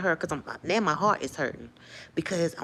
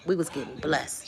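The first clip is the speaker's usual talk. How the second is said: ordinary talk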